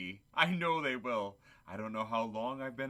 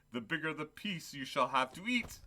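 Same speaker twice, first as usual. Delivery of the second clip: ordinary delivery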